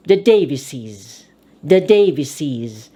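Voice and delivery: "Davises" ending in a clear -iz. Shown as a demonstration. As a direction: pronounce the ES of "Davises" as IZ. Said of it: "The E S is pronounced I Z".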